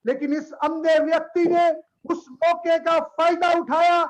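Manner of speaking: normal talking voice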